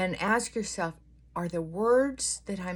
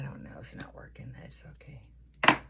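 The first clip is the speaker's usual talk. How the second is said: whisper; 6 semitones lower, 4 dB quieter than usual. Real, not acted. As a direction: breathe it out under your breath. Whispers to herself at the mirror.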